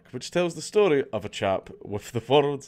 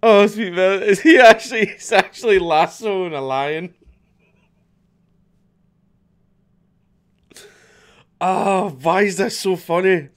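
much higher, louder amazed outburst; astonished laughter; 7 semitones higher, 7 dB louder than usual. Real, not acted. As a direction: react in disbelief, laughing. Shocked at lion lasso clip.

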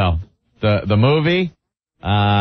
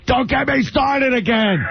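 mock screaming; 12 semitones higher than usual. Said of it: "Opie just starts screaming".

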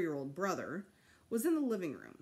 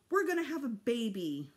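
higher, louder mother voice with the big news; mother's happy news; 4 semitones higher, 4 dB louder than usual. A narrator's reading. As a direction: mom breaks the news to her son, lifted. Mother announces the baby.

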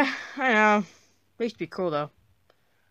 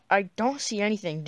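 ordinary delivery

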